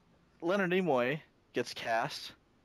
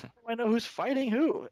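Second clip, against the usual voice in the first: higher, exasperated cry; exasperated mock confusion; 9 semitones higher than usual. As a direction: act baffled by the gray robots.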